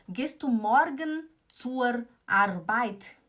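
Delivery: MORGEN, ZUR and ARBEIT with hard pronounced Rs; wrong-example German with heavy Rs in "morgen zur Arbeit"; mixed Swiss-German R. Shown as a demonstration. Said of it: "é feio falar assim".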